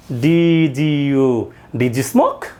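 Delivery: ডিজিইউ, did you said slowly with d and y fused into j; native-style blending. Shown as a demonstration. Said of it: "নেটিভদের মতো করে কথা বলা". (নেটিভদের মতো ডিজিইউ উচ্চারণ)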